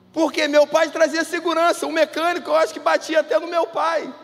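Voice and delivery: loud, high preaching, pressing each point; preacher proclaiming his father brought security; full-force preaching. Acted. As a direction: drive it home to the congregation.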